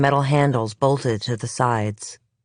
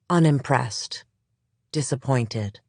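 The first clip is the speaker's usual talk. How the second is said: ordinary delivery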